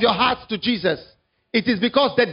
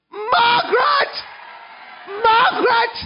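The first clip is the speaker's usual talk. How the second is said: crying shout; 11 semitones higher, much slower than usual. Retold or imitated. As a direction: call out to her, sobbing and shouting. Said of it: "he shouted".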